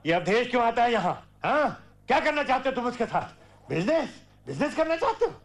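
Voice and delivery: fast, high-pitched grilling; angry interrogation. Acted. Father demanding answers from his son, angry and rapid. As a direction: fire the questions at him, pitch rising.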